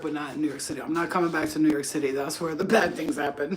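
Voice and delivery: ordinary talk, nothing marked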